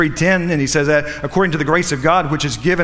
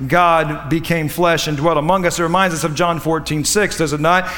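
normal talking voice

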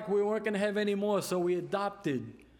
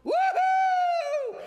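high, loud "woo-hoo" of celebration; shrill-pitched shout imitating an overjoyed coworker; whooping holler; 6 dB louder than usual. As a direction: let out a piercing, joyful whoop. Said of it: "screaming and hollering".

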